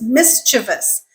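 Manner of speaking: mischievous as MIS-chuh-vus; correct pronunciation. Shown as a demonstration. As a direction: say mischievous with stress up front. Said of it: "The correct way to pronounce that word".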